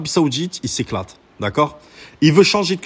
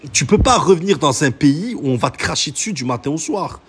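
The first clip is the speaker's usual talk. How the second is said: ordinary talk, nothing marked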